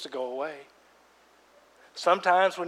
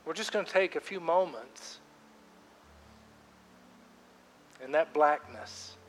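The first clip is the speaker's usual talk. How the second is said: guided prayer; 10 dB quieter than usual. Acted. Pastor guiding congregation in silent prayer, softly. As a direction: lead them gently into quiet prayer. Leads a quiet prayer time.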